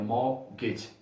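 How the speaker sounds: मॉर्गेज said softly with no r and no t; quiet British मॉर्गेज, r dropped; no r sound. Shown as a demonstration. Teacher showing the r left out, मॉर्गेज. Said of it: "आर की आवाज़ नहीं आएगी".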